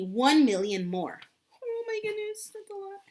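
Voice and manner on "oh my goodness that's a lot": high, wide-eyed oh my goodness; amazed. Acted. Reading to a child, she marvels at the huge number in a high voice. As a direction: act amazed for the young listener. Reader marvels at animal count.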